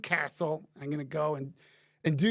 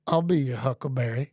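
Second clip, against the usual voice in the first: Val Kilmer quote; 6 semitones lower, 3 dB louder than usual. Retold or imitated. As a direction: lightly voice the gunslinger's cool line. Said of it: "Tombstone, Val Kilmer".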